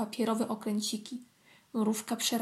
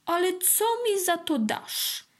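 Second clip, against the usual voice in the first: slow, high, pointed question; bargaining beetle; 7 semitones higher, slower than usual. A narrator's reading. Beetle asks the ant for payment, bargaining. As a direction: draw the question out, pitched up.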